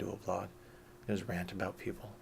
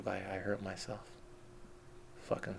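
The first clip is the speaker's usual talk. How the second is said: ordinary talk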